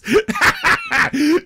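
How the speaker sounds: a loud high burst of laughter, real. Real, not acted. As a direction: crack up loudly at your own joke. Laughs at his own tea joke.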